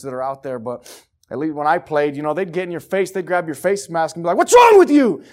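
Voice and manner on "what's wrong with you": high, full-force coach's yell; furious sideline shout, acted; barking coach. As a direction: yell it right in a player's face. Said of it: "come start barking in your ear".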